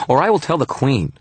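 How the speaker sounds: high-pitched little fairy, demanding; tiny captive giving orders, pitched high; Toot's demand. A narrator's reading. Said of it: "he demanded".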